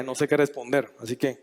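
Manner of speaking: normal talking voice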